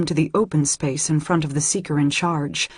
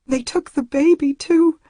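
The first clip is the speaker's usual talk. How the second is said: much higher, breaking distress; anguished; 10 semitones higher, 4 dB louder than usual. A narrator's reading. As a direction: let the voice crack with horror. Horrified girl after the abduction.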